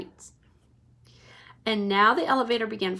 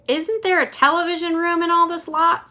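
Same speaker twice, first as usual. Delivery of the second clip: put-on whiny eager kid; a pushy kid wanting TV; boy demanding; 7 semitones higher, 5 dB louder than usual. A narrator's reading. Mike TV asking Wonka for a TV room, eager. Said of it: "asked Mike T V".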